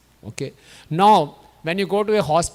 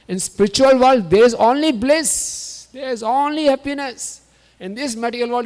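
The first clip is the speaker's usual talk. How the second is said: high, emphatic sermon delivery; impassioned preaching; 7 semitones higher, 5 dB louder than usual. Real, not acted. Preacher contrasting spiritual and material world, impassioned and high. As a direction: drive the point home with raised pitch, stretching key words.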